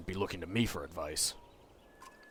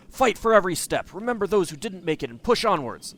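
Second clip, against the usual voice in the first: rallying shout; 9 semitones higher, 8 dB louder than usual. Acted. Rallying speech to the caravan.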